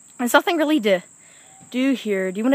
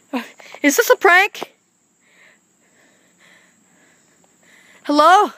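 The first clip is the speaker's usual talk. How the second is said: high, loud frantic panic; panicking gamer; 6 semitones higher, 3 dB louder than usual. Real, not acted. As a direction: blurt it out in real panic. Chased, no place to go.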